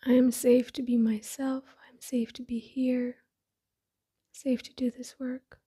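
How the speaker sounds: soft, breathy meditation voice with long pauses; hushed, unhurried affirmations spoken as if eyes closed; guided meditation. Acted. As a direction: settle inward, softly, phrase by phrase.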